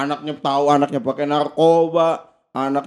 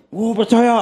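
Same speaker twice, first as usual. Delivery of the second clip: higher, hammed-up enthusiastic reply; mock eager agreement; 7 semitones higher, 4 dB louder than usual. Guest imitating a parent claiming to believe in democracy, mock eager. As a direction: play an all-too-willing parent, pitched up.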